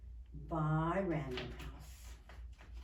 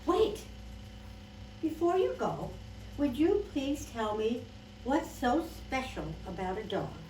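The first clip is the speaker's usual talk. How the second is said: high, loud call, then easing down; lightly acted urgent appeal; pleading to stay; 6 semitones higher, 4 dB louder than usual. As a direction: stop her before she leaves. Keeper stops girl from leaving.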